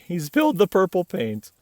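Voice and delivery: ordinary delivery, nothing marked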